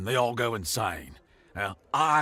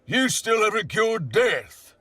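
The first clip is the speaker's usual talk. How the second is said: angry taunt; 11 semitones higher, 5 dB louder than usual. A narrator's reading. Counselor's furious escalating taunt.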